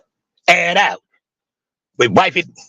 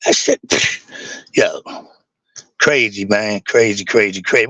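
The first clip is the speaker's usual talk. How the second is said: choked up; 6 semitones lower than usual. Real, not acted. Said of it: "I heard it in your voice".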